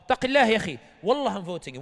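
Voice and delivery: loud, insistent protest; pleading with a friend at a meeting, a loud mock outcry; loud retort. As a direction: call out fear Allah loudly. Quoted exchange at meeting.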